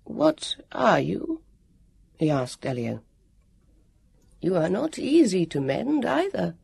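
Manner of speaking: a skeletal guardian puzzling aloud; strange old warrior. Acted.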